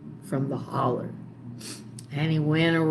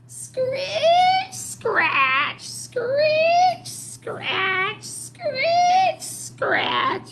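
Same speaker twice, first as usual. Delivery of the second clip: high, loud squeaky scratching chant; witch's squealing scratch noises; scratchy scritch-scratch; 6 dB louder, much slower than usual. Acted. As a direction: screech it like a knife on stone. Old woman scraping sound.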